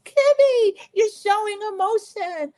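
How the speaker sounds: very high gleeful mock shriek; hammed-up squeal in a put-on accent; Missy yelling. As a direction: imitate a friend crowing, loud on the name. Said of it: "Missy is having the greatest time saying".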